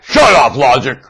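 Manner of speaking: loud shouted command; mock yell. Preacher voicing sellers yelling at logic, a loud shout. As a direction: yell it like they do. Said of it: "You tell logic".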